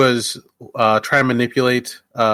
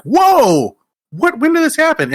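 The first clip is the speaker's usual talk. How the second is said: loud, high astonished whoa; blown away; 4 dB louder than usual. Retold or imitated. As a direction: act out a stunned double take. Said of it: "we've just been kinda blown away by".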